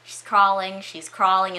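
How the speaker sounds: plain reading voice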